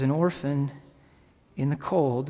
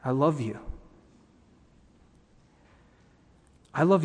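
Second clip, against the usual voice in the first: gentle strength; 3 semitones lower than usual. Acted. Preacher voicing the Father to a beloved child, gently strong. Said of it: "with the most gentle strength".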